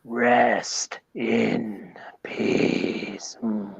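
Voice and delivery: very low, very slow rest in peace; low slow mock deadman intone; Undertaker impression. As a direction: drag it out deep and ominous. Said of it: "that was pretty good".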